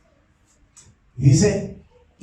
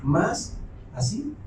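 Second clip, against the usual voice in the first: softened despair; 8 dB quieter than usual. Acted. Preacher voicing David's despair.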